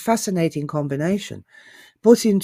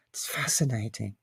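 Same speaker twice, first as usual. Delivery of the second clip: whispered awe; 6 semitones lower, 9 dB quieter than usual. Real, not acted. Awed aside about fairy tales.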